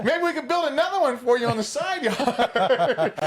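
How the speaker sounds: high, laughing salesman impression; mock-hopeful pitch, high and loud; scrambling salesman. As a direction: imitate a caller improvising a sale, cracking up. Acting out caller's scrambling pitch.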